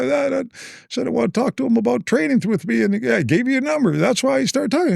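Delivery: high-pitched imitated caller explaining himself; Bruce Baumgartner. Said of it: "He goes".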